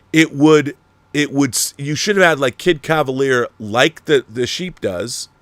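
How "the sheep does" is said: ordinary talk, nothing marked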